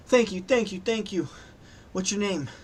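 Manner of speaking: high, breathless rush of thanks; acted desperate gratitude; grateful distressed woman. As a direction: voice a relieved survivor, pitched high. Rescued panicking woman thanks the paladin.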